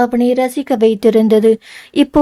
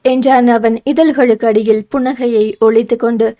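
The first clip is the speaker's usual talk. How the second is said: ordinary delivery, nothing marked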